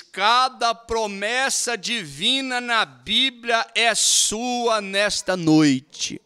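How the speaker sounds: slow, measured reading aloud; reading the quote. Shown as a demonstration. Preacher reading from the text to the church, measured reading. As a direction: read the line out steadily first. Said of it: "Ele diz aqui".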